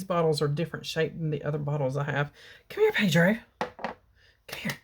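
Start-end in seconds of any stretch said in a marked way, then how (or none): 2.7-3.4 calling the cat. Real, not acted. 3.6-4.7 whispered coaxing. Real, not acted. Coaxing the cat quietly.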